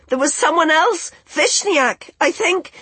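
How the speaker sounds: very high shouted protest; shouting. A narrator's reading. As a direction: call it out high, pleading your innocence. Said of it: "she shouted".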